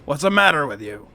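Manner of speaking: loud gruff hobgoblin scolding. Acted. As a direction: bark it like a hobgoblin boss. GM voicing hobgoblin scolding goblins.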